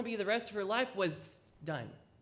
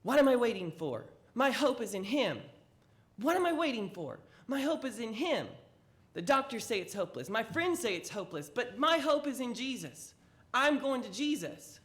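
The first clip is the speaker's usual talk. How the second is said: loud, high, insistent proclamation; preaching it higher and louder, full conviction; fervent declaration; 6 semitones higher, 7 dB louder than usual. Acted. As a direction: build into a triumphant vow. Woman's rising declaration of hope.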